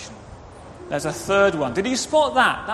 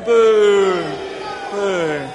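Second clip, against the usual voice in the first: shouted pantomime boos; loud boo; 5 dB louder than usual. Pretend. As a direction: lead the children in booing. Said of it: "Can we boo at him?".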